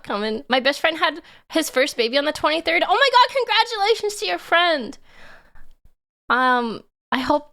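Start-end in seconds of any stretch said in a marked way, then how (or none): 2.8-5.0 excited. Real, not acted. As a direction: burst out in delight. News of a baby.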